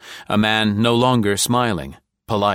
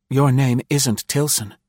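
cool; 6 semitones higher than usual. A narrator's reading. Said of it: "Nancy said coolly".

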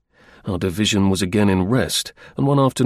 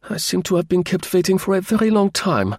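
annoyed; 8 semitones higher than usual. Acted. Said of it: "in an annoyed kind of voice".

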